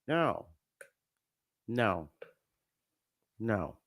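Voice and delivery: three defeated no's trailing off; fading no's. Real, not acted. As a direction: let the denials sink into despair. Overcome by painful history.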